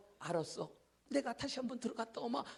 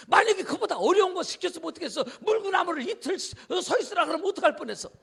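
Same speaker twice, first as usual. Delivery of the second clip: pressing; 7 semitones higher, 8 dB louder than usual. Real, not acted. Preacher presses the point.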